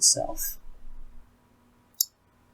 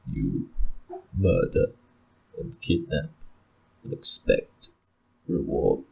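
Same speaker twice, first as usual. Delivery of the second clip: Ulfric's scorn; 12 semitones lower, 6 dB quieter than usual. Acted.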